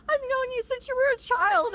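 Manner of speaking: very high, emotional fan outpouring; teary gush. Retold or imitated. Fan telling the Charlie actor she knew him as a child, high gush. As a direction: gush at a grown child star. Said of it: "I'm like".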